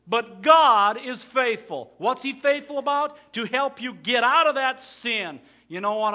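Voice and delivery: loud, high sermon voice; full-force preaching. Acted. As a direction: proclaim it to the whole room.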